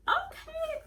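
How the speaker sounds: high, loud, startled squeak; acted-out startled reaction; stunned okay. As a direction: squeak it out in mock shock. Retold reaction to test result.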